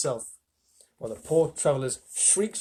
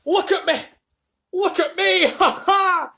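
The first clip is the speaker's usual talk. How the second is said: much higher, louder gleeful shout with a laugh; excited shout; 7 dB louder, slower than usual. A narrator's reading. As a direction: crow with joy like a freed centipede. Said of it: "shouted the centipede excitedly".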